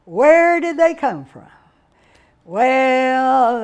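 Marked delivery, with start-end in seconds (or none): none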